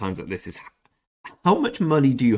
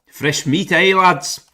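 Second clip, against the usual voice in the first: high, loud jeering cheer; hammed-up fresh meat jeer, much higher and louder; gleeful taunt; 9 semitones higher, 8 dB louder, much slower than usual. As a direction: crow at the new arrival. Taunting new arrival in server.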